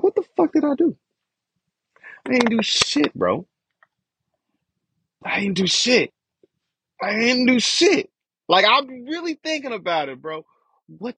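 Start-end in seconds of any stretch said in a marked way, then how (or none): 0.0-8.1 high, heated disbelief; exasperated protest. Retold or imitated. Protesting to the family that he did nothing, high exasperated pitch. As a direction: plead innocence in frustration. Protesting his innocence to mom.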